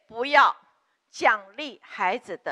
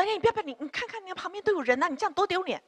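put-on high, hushed coaxing of a tantrum child; pleading parent; 6 semitones higher, 4 dB quieter, faster than usual. Mother to crying daughter in supermarket, high hushed pleading. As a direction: beg the child to stop, pitched up but soft. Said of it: "就说".

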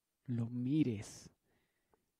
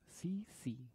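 whisper; 3 dB quieter than usual. Acted. Whispering so father won't hear.

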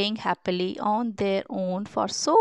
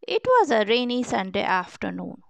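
ordinary delivery

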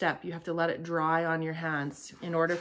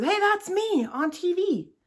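much higher, slow cartoon germ; cute germ voice; 12 semitones higher, 4 dB louder than usual. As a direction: squeal it like a proud little germ. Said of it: "he says".

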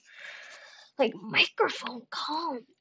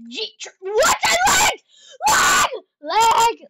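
high loud shout of outrage; frustrated shout; 11 semitones higher, 10 dB louder, much slower than usual. Real, not acted. Gamer lagging in a fight, frustrated shout. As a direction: yell at the lag.

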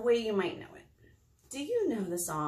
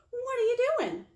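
high raccoon voice; 4 dB louder than usual. Acted. Raccoon question.

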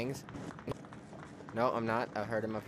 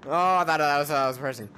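ordinary delivery

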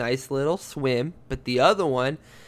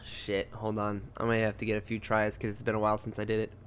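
ordinary talk, nothing marked

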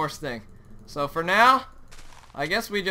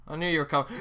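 ordinary talk

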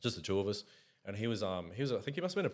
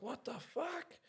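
shocked missus; 10 dB quieter, much slower than usual. Retold or imitated. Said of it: "she's like".

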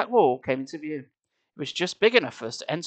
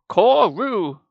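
slow, loud, dramatic drawing out of Koru; ominous villain name; 5 semitones higher, 7 dB louder than usual. Acted. Reader echoes the villain's name, slow and booming. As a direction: boom the name like a movie villain.

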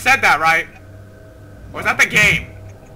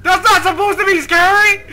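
high, loud indignant outcry; startled protest; 8 semitones higher, 5 dB louder than usual. Real, not acted. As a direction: protest loudly right after a scare. Protests after a jump scare.

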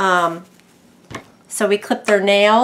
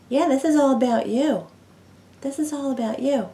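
tender pet talk, higher and hushed; cooing to pet; 4 semitones higher, 5 dB quieter than usual. Real, not acted. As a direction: sweet-talk the bird, getting softer. Cooing to the excited bird.

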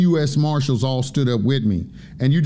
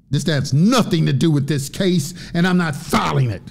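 indignant refusal; 3 semitones higher than usual. Retold or imitated. Judge refusing the defendant's paperwork, indignant. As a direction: act out a judge digging in, hitting key words hard.

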